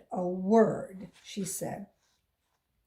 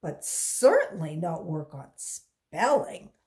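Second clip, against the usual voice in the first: stern man; 7 dB louder than usual. A narrator's reading. Man accuses girl.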